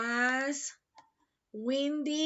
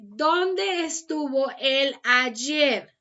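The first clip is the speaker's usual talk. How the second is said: slow translation; 6 dB louder, much slower than usual. Shown as a demonstration. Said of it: "teacher translates slowly for class".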